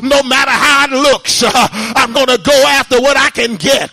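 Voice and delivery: loud, rhythmic sermon delivery with uh breaths; fiery preaching. Acted. Preacher urging the church to call on God, fiery preaching. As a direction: preach it hard to the congregation.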